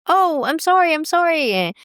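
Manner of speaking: high-pitched, imitated apology; retold flustered apology. Women in the toilet apologizing to speaker, high-pitched and apologetic.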